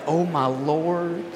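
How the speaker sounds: slow soft groan at the weather; dismayed. Retold or imitated. Checking Friday's forecast for today, a slow soft dismayed groan. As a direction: drag it out, dreading the cold. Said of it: "I looked at the temperature".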